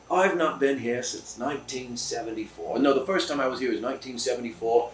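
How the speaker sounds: put-on a loose British accent, chuckling through the tale. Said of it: "It's British, okay?".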